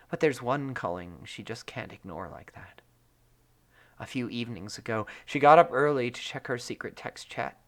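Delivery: lively story narration. A narrator's reading. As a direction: read the fic aloud to listeners. Reads fic narration.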